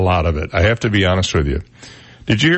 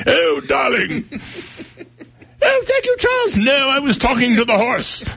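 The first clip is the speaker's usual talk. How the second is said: very high falsetto British caricature; hammed-up royal couple in squeaky falsetto; mock British royals. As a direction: play posh British royals in a silly high voice. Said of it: "That's what I think of when I think of Camilla".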